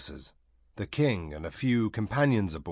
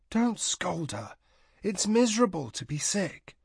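gentle plea; 10 semitones higher than usual. A narrator's reading. Tahanu pleads for Sazerac.

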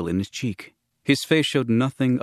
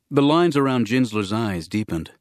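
ordinary delivery, nothing marked

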